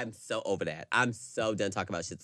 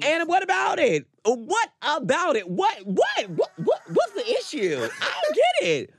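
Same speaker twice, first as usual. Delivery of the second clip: shrill laughing outrage; 6 dB louder, slower than usual. Real, not acted. Laughing mock-outraged defense.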